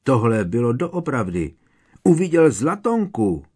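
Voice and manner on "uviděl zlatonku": a higher, excited shout. A narrator's reading. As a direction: call out the sighting. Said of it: "křikl Harry". (vzrušený výkřik)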